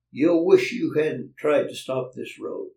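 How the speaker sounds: booming bully's warning; angry threat. Retold or imitated. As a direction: lean on it like a bully. Goldie threatening him in restaurant.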